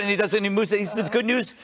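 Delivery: ordinary delivery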